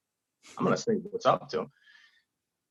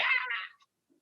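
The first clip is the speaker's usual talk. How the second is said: mock fan shriek; 8 dB quieter than usual. Crowd shrieking at Seinfeld.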